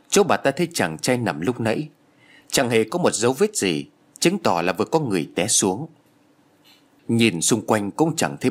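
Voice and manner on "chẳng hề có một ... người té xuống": usual speaking voice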